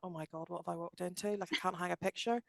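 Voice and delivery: quietly mock your own bewilderment; dropped to a small, soft inner voice; hushed retold thought. Voicing past bewildered thought aside.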